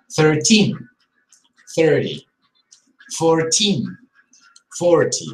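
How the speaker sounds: put-on "Thirteen" stress on teen, "forty" stress on for; number stress contrast. Shown as a demonstration. Teacher drilling number stress for learners. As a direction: carefully mark where the stress falls. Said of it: "an important difference".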